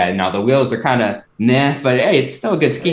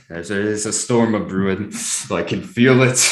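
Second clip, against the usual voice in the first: ominous; 6 semitones lower than usual. Acted. Ominous storm a brewing.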